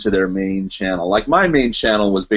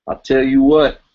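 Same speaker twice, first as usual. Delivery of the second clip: put-on Texas accent; 4 semitones higher, 4 dB louder than usual. Streamer joking to viewers in his best Texas accent. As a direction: slip into a hammed-up Texas accent, like Hank Hill. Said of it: "that's my best Texas accent".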